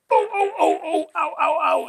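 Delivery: high, laughing mock groans of pain; cramping groans. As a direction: yelp through a leg cramp. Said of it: "must look funny because I'll be like".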